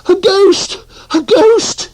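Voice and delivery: little bear shrieking in fright; frightened cry. Acted. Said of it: "he cried".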